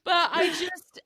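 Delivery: tearful, high, breaking voice with laughs; laugh-crying through the words. Real, not acted. Cohost crying with laughter while praising her friend. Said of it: "I'm sorry that I'm crying".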